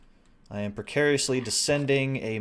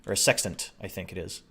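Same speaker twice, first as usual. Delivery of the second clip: ordinary talk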